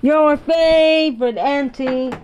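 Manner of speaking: high, bouncy silly-aunt greeting; playful auntie voice calling hello; silly Auntie Sissy. Acted. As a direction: greet the kids as a goofy favorite aunt. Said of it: "it's your silly Auntie Sissy".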